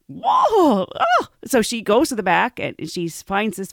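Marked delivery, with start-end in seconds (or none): 0.1-1.3 high, loud startled exclamation; shocked whoa. Retold or imitated. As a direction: gasp out a surprised whoa. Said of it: "So she is like".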